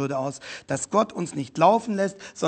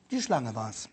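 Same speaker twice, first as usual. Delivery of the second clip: Eva's excuse; 7 semitones lower, 8 dB quieter than usual. Acted. Preacher voices Eva's excuse.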